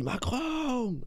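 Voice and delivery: high, drawn-out mock yell; playful high-pitched call, falling at the end; mock cry. Shown as a demonstration. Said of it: "si tu cries".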